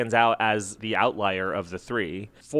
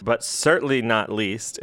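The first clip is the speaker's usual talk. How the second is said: ordinary talk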